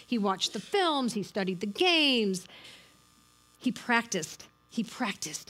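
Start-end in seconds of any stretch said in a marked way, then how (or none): 4.7-5.5 hushed whisper of the same words; whispered repetition. Shown as a demonstration. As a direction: drop to a breathy whisper for "He practiced". Repeated, second take whispered.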